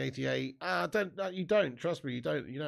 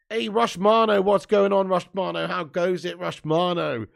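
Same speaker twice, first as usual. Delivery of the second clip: loud, raised call to a viewer; excited greeting; 4 semitones higher, 8 dB louder than usual. Real, not acted.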